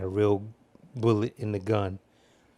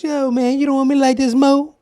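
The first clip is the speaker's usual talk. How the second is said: high, loud, fast imitation of a movie character; cool guy voice; 9 dB louder than usual. Friend quoting favorite scene from The Crow, cool guy voice.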